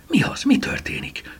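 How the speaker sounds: high, impatient stage whisper; eager, impatient questioning. A narrator's reading. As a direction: press for an answer in a high, breathless hush. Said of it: "türelmetlenkedett Eleonóra".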